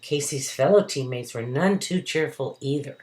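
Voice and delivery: ordinary delivery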